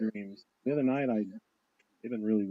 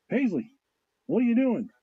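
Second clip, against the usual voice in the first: put-on higher, sing-out questioning of a child; retold parental alarm; 10 semitones higher, 5 dB louder than usual. Retold parent to child staring into the bathtub, alarmed. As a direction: call the name, then ask, pitched up.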